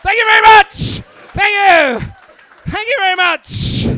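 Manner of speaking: very loud, high yell; full-volume high-pitched shouting; shouted thanks. Real, not acted. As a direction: bellow it over a roaring crowd. Yelling thanks over audience noise.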